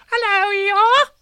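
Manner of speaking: very high, loud squeak; squeaky Roo. Acted. Roo squeaking a greeting to Pooh at the party, very high and loud. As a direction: squeak it like an excited baby kangaroo. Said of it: "said Roo".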